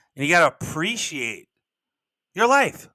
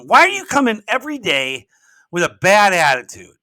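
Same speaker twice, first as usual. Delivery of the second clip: higher, louder mock scolding; scolding mom; 6 semitones higher, 8 dB louder than usual. Mom confronts her son over his morning mood, scolding loudly. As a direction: imitate an exasperated mom telling off her son.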